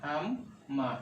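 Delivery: হাম, a short pause, then মা; doubled m demo. Shown as a demonstration. Teacher shows learners that mim comes twice, split doubled-m demo. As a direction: split হাম and মা so both m sounds are heard. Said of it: "মিম কিন্তু দুবার আসছে".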